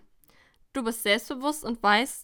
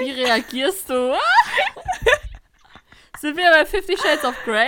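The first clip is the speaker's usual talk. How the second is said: laughing; 6 semitones higher, 9 dB louder than usual. Real, not acted. Asking her friend while cracking up at the quiz. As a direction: crack up as you ask.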